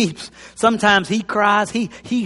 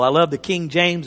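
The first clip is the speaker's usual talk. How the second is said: ordinary talk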